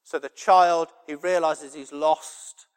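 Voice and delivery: loud, emphatic pulpit delivery; full-force preaching. Real, not acted. Preacher on the heart of the Father, loud and impassioned. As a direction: preach it at full volume, driving each phrase home.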